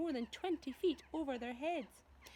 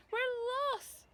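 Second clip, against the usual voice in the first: high, louder despairing cry; panicked cry; 14 semitones higher, 5 dB louder than usual. Acted. Ladybird crying out in despair as they fall. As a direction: wail that all is lost. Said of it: "cried the ladybird".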